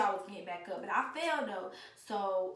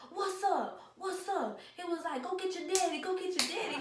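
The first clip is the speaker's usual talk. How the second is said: higher, clipped mock challenge; imitating the uncle; 10 semitones higher than usual. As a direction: square up like the uncle calling out the girl. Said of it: "He was talking like this".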